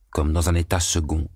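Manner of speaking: ordinary delivery, nothing marked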